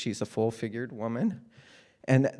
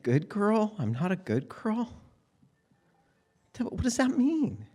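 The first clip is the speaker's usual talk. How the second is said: put-on confused child; 11 semitones higher than usual. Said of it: "And he said".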